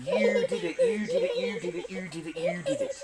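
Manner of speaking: high teasing singsong; mock-accusing singsong. Dad teasing his child about the fire, high singsong. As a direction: tease the kid with a high singsong.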